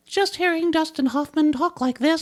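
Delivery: high cartoon mouse voice; Mickey Mouse voice. Shown as a demonstration. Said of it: "you sound like Mickey Mouse".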